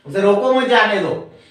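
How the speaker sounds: उसे रोको मत जाने दो run together without a break; no pause. Shown as a demonstration. Teacher showing the unclear sentence, no pause. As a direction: say उसे रोको मत जाने दो as one unbroken phrase. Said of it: "इससे स्पष्टता नहीं है".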